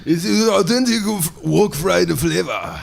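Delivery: high, slow, shouted ninja voice. Pretend. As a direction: bark it out like a movie ninja. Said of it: "say that in your fucking, uh, ninja voice".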